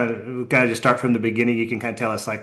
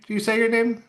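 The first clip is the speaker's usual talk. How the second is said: normal talking voice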